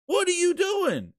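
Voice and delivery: high, slow, baffled outcry; exasperated. Real, not acted. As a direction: cry it out in disbelief. Exasperated outburst.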